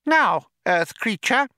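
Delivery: high mouse voice, self-important; a high-pitched little chairman; Benji Mouse. A narrator's reading. Mouse addresses Arthur formally, high mouse voice.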